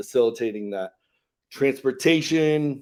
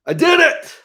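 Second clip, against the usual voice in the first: high, loud shout of triumph; triumphant cry; 9 dB louder than usual. Retold or imitated. Storyteller shouting the boy's win, high and loud. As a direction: throw it out like a kid who just won.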